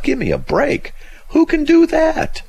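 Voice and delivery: very high, exasperated protest; incredulous outrage. Real, not acted. Host scoffing at having to obey the law, high and incredulous. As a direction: throw it out high in disbelief.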